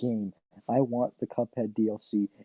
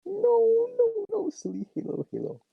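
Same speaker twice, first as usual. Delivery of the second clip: squeaky mock voice. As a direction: squeak it out like a cartoon. Mocking co-host's forerunners joke.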